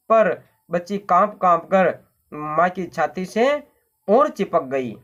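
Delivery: usual speaking voice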